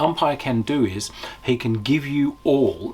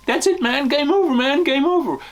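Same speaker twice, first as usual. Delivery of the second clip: high, loud panicked wail of a doomed soldier; hammed-up movie-style panic, pitched way up; panicked marine quote; 5 dB louder than usual. Reviewer acting out a panicking marine, in a high hammed-up wail.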